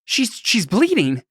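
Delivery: high-pitched, stammered worry; acted shocked concern; alarmed. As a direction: blurt it on finding a friend hurt. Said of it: "rushes over to her and it's like".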